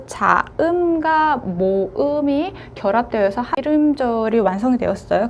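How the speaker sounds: ordinary talk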